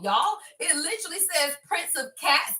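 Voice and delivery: ordinary talk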